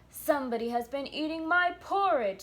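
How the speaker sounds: lightly voiced gruff papa bear, loud; great gruff bear. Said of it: "in his great rough, gruff voice".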